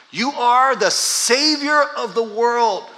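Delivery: slow ringing proclamation; proclaimed loudly. Shown as a demonstration. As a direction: proclaim it as a big statement. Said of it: "That's a big statement".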